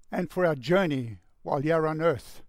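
usual speaking voice

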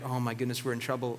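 gulp, caught by the parent; mock worried. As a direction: siblings realising they're in trouble, softly. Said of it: "Aaron and Miriam are like".

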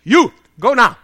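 acted military order; sergeant's curt order. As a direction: dismiss the prisoner sharply. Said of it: "Sergeant looks at you and says".

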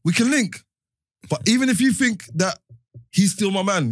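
loud, high-pitched protest; heated insistence. Real, not acted. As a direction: push the point over people talking. Insisting over others' objections of cap.

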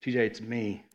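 soft, hushed, intimate; gentle whisper of God calling a name; quiet God voice. Retold or imitated. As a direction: say it close and low.

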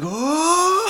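high mock scream; hammed-up high wail; imitated scream. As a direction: let out a high-pitched movie-hero cry. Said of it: "and he's like".

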